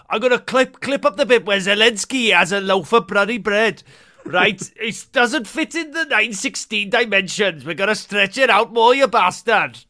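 imitating a Welsh newsreader making a clip in a Welsh accent. Podcaster impersonating the newsreader in a light Welsh accent. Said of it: "You've got quite a good Welsh accent".

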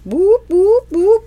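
very high, loud boops; mock alarm boops. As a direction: imitate a buzzer going off. Imitates an alert at magic word.

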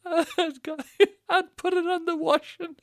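high, loud, squeaking through laughs; helpless laughter. Real, not acted. Comedian telling on himself, helpless high laughter. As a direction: barely get the words out for laughing. Cracking up mid-story.

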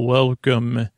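usual speaking voice

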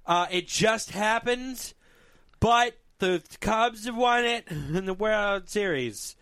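high, slow news announcer. Pretend. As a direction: hype it like breaking news. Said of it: "Breaking news".

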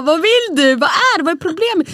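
mother fed up with her screaming daughter, impatient; impatient mom. Retold or imitated. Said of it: "Man bara".

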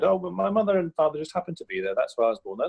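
lightly voiced dad explaining; a hint of his father's breezy excuse. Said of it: "he always said".

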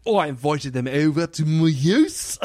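hammed-up Australian accent; exaggerated Australian, showing off on request. Shown as a demonstration. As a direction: do a broad, mock Aussie accent. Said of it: "do it with an Australian accent".

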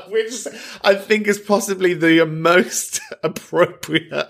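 high, slowed by real laughter; cracking up while explaining; laughing. Real, not acted. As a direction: talk through helpless giggles. Laughs at crisp in throat.

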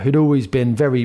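ordinary talk

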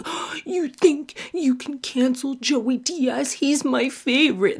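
high, slow, babyish whine mimicking a crying fan; mocking high whine of an upset fan; whiny fanboy. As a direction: whimper it like a baby losing its dummy. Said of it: "They're like".

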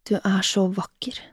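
soft, understated murmur. A narrator's reading. Man admiring his lover, murmured. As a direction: murmur the compliment softly. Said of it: "mumlet han".

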